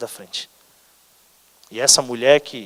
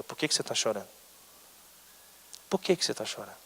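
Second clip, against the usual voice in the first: soft, low, tender question; hushed and low, leaving room to answer; gentle questioning; 4 semitones lower, 10 dB quieter, faster than usual. Acted. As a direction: ask very quietly, as a comforter. Jesus gently questions the weeping listener.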